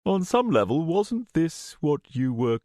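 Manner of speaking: airy, leading tone; insinuating villain. A narrator's reading. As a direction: needle her with polite suggestion. Villain presses her after refusal.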